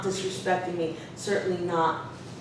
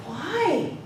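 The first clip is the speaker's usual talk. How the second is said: lightly imitated kid, pitched high; youthful childlike student; 8 semitones higher than usual. Student surprised by his teacher's scolding, lightly voiced childlike question. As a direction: ask it with childlike innocence. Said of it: "childlike way of speaking".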